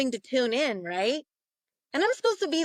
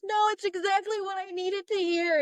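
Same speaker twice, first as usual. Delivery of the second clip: bawling; 9 semitones higher than usual. Retold or imitated. Client reassures the medium through tears, mock bawling. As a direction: imitate someone overcome with crying. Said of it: "she's just, like, bawling".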